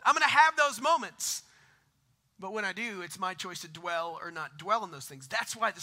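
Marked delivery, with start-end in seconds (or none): 0.0-1.4 emphatic. Real, not acted. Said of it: "That's not gonna happen".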